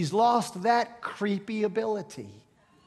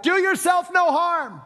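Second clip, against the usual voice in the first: shouted much higher and louder to reach the jailer; loud, higher urgent yell stopping a man from harming himself; 8 semitones higher, 5 dB louder than usual. Retold or imitated. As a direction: call out across the pitch-dark prison. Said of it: "Paul yells out".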